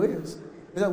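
usual speaking voice

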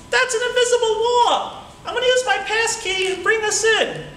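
high, cartoonish little monkey voice; talking monkey. Caged monkey begging the boy to free him, high voice. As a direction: squeak it out like a tiny caged monkey.

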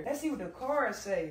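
ordinary talk

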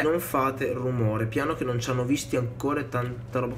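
quiet sneaking voice; hushed. Real, not acted. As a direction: keep it down so they don't hear. Sneaking up, asks for silence.

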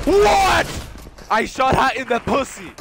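high, loud shouting; shrieked astonishment; hysterical disbelief. Real, not acted. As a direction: yell it, amazed at your own shot. Hysterical disbelief at own shot.

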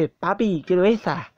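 lightly voiced toddler asking daddy; a hint of little kid pleading; baby voice. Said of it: "Así con voz de bebé".

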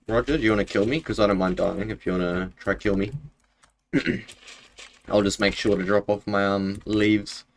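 normal talking voice

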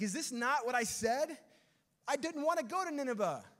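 high-pitched, protesting mock outburst; indignant complaint. Jonah complaining to God about Nineveh, indignant complaint. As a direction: protest like an aggrieved prophet, voice pushed up.

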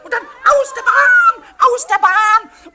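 high, loud laughing yell; shouting demo. Shown as a demonstration. Pumuckl shows Eder how to yell on the slide. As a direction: holler it down the slide, slow and gleeful. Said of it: "dann musst du schreien".

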